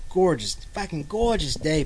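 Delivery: usual speaking voice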